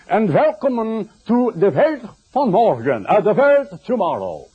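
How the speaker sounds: high, slow, hammed-up German accent; mock German accent. Preacher imagining his father greeting radio listeners as a German, mock German accent. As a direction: play a German radio evangelist with a thick accent. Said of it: "So he didn't send a German".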